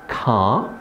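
normal talking voice

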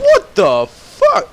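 high, slow stunned yell; shocked outburst. Real, not acted. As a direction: shout it in disbelief. Sudden enemy appears.

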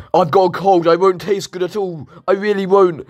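high, pleading squeaky bacon voice. Acted. Bacon begging the chef not to cook it, high pleading voice. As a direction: beg for your life like a terrified strip of bacon.

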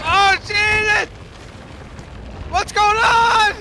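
high, slow, panicked shout; panicked yell. Real, not acted. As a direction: yell out in real alarm. Ship sinking, panic.

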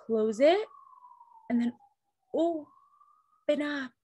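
plain reading voice

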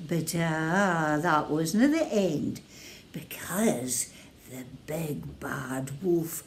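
whispered storyteller suspense. A narrator's reading. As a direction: hush it down for suspense.

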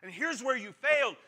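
loud, high, hammering accusations; finger-pointing accusation. Acted. Crowd pointing fingers at someone's failures, loud accusing. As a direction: fire off each charge at full voice. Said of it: "point their fingers and say".

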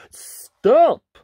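sudden high, loud shout; wolf calling out at full volume; deafening call. A narrator's reading. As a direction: halt everyone with one big yell. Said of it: "in a deafening voice".

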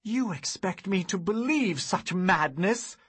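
loud, high disbelief; incredulous. Acted. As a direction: scorn the claim. Hero disbelieving.

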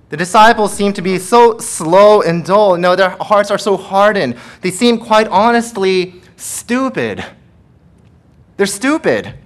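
loud, high, driving sermon delivery; fiery pulpit preaching; preaching at full force. Acted. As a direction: preach it hard to the congregation.

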